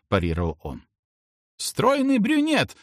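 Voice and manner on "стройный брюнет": very high, drawn-out squeal; high-pitched, slow exclamation; old lady's squeal. A narrator's reading. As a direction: squeal out a quiz guess. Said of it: "взвизгнула седовласая старушка".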